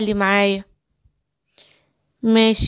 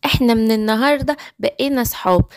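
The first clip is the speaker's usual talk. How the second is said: ordinary delivery, nothing marked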